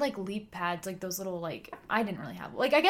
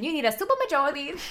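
high, loud put-on quote; imitated show line; 7 semitones higher, 5 dB louder than usual. Showing her favorite Succession line, hammed-up imitation. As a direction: deliver it in a hammed-up character voice. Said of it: "The Succession".

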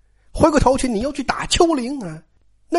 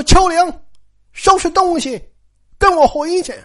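stern order; 9 semitones higher than usual. Retold or imitated. Aunt Xue orders maid away angrily.

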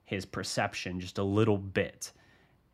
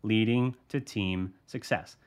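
"leading to team success" slowed right down, each word set apart; serious and deliberate, punctuate every word of "leading to team success"; slowed, punctuated emphasis. Retold or imitated. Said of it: "slowed down and, like, really punctuated the words".

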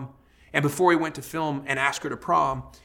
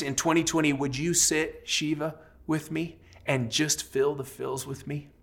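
softer, unhurried prayer with long pauses; heartfelt prayer; 5 dB quieter than usual. Real, not acted. Preacher asking God to sit with the grieving, tender prayer. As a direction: plead gently with God, leaving space between phrases.

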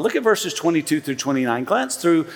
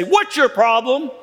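in-your-face challenge, higher pitched; accusatory; 7 semitones higher than usual. Shown as a demonstration. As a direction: poke the question at them. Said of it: "in your face and I maybe poke you".

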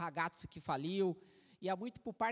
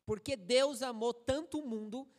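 scripture reading; 2 semitones higher, 4 dB louder than usual. Acted.

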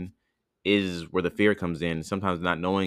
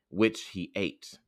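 ordinary delivery

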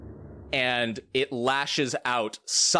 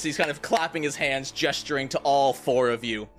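usual speaking voice

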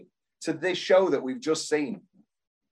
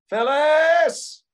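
loud, high cry of a name; a desperate call into silence; 12 semitones higher, 7 dB louder than usual. Retold or imitated. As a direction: shout the name across the empty stage. Calling out in retold scene.

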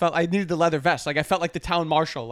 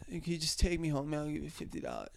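put-on a touch of slurry drunk kid, softer; drunk college kid; 4 semitones lower, 13 dB quieter than usual. Friend imitates the drunk guy who got in his car, softly. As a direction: lightly mimic a drunk asking a favor. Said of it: "so he talked like this".

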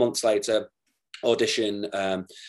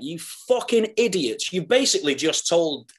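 incredulous telling-off, pitched up; exasperated scorn; 7 semitones higher than usual. Retold or imitated. Actor silently judging rival auditionees, exasperated.

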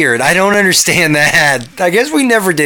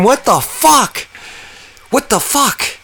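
usual speaking voice

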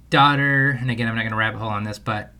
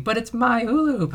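much higher whiny mock wail; imitating a wailing child; 12 semitones higher than usual. Dad imitating daughter melting down over hula hoop. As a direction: play the kid in full meltdown. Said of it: "melting down, screaming".

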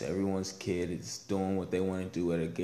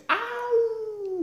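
imitating a toddler's pained yelp, much higher; mock scream; 4 dB louder than usual. Dad imitating daughter's fake pain, high mock yelp. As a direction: squeal like a child faking pain. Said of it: "she screamed like she was in so pain".

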